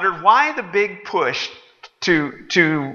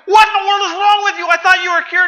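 much higher, louder yelling; outraged shout; 5 dB louder than usual. Acted. Waiter drenched again, yelling at the customer. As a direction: scream it in outrage.

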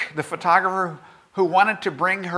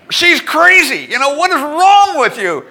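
much higher and louder, laughing in disbelief; exasperated outburst; 9 semitones higher, 9 dB louder than usual. Real, not acted. Preacher marvels at the peacock woman, loud laughing exasperation. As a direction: throw up your hands at the absurdity.

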